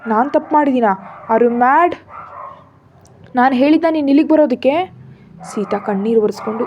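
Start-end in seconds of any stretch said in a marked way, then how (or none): 0.1-5.0 rising, louder, thrown-off retort; flustered outburst. A narrator's reading. Husband rattled by his wife's accusation, flustered and loud. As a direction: caught off guard and lashing back. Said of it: "Ram ತಡಬಡಾಯಿಸ್ತಾ".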